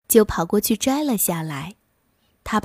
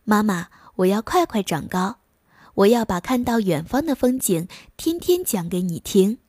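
ordinary delivery

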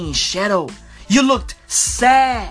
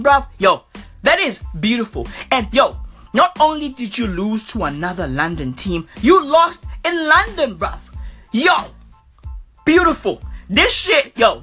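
ordinary talk, nothing marked

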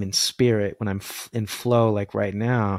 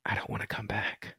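breathy retold whisper, much softer; 14 dB quieter than usual. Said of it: "I literally said out loud".

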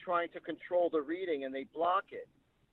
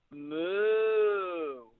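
a drawn-out imitated cow moo. To the lady who lost her mother, an imitated cow moo. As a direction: moo at her like one of the cows. He moos at the grieving client.

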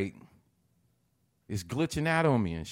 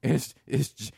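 ordinary talk, nothing marked

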